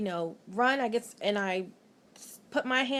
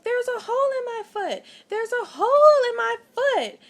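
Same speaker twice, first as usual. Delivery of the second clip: high, loud panicked yelling; panicked screaming; 14 semitones higher, 10 dB louder than usual. Retold or imitated. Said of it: "like, screaming".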